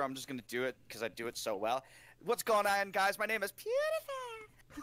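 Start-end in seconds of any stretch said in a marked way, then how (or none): 2.2-4.8 intro climbing to a high, stretched name; mock YouTuber greeting, squealing the name long; Pewdiepie. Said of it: "if I can make a fun of any YouTuber".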